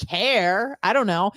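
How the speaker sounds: ordinary talk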